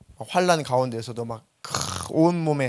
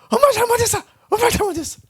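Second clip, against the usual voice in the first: put-on much higher, whiny little boy begging; whimpering child; 4 dB louder, faster than usual. A little boy begging his mother mid-spanking, high and whiny. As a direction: squeak it out like a small boy caught. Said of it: "우리 동네는 이제 부모와 자식 간에 좀 반말 섞어서".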